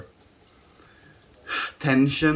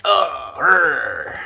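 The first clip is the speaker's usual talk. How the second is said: higher, loud growl of annoyance; poked lion growl; 5 semitones higher, 6 dB louder than usual. Retold or imitated. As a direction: growl like a lion being prodded. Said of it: "it's like poking a lion".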